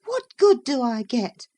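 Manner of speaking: high, loud, rising annoyance; growing irritated. Acted. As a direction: let the irritation build, pitched high. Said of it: "growing more irritated".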